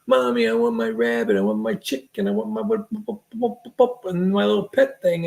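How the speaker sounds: imitated kid begging Mommy; pleading child. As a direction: babble it like a nagging child. Voices a child begging for pets.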